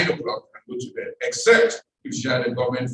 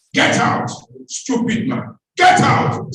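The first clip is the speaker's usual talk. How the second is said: loud, high shouted order out of the house; mother's shout; 10 semitones higher, 6 dB louder, slower than usual. Retold or imitated. Mother shouting a rich visitor out of her house, furious yell. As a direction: yell him out like an outraged mother. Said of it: "I heard my mother shout".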